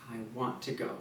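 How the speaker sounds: I want to go dropped low and soft. Shown as a demonstration. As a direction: settle back and say it quietly. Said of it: "lean back when you say".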